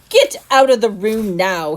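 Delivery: loud furious order; roared. Acted. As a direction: roar him out of the room. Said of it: "he roared".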